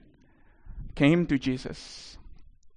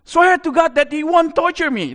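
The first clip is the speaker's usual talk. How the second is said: high, loud demonic shout; full-voiced, high-pitched screamed plea; screaming loud demon voice; 13 semitones higher, 8 dB louder than usual. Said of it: "He screams at that time with a loud voice".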